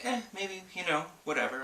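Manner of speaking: high-pitched mock indifference; noncommittal shrug. Wife recalling doubts about a free game, high noncommittal voice. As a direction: toss it off high, as a verbal shrug.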